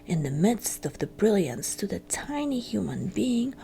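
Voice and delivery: usual speaking voice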